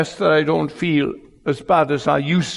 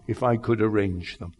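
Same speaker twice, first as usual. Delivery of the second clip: normal talking voice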